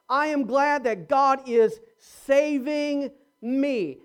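usual speaking voice

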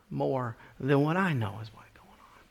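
whispered aside; hushed preacher whisper. Real, not acted. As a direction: drop to a whisper. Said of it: "whisper heard".

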